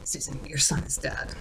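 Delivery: a flat whispered imitation of the FBI's bluntness; mock cold whisper. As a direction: hush it like a cold, offhand announcement. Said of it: "They're just like".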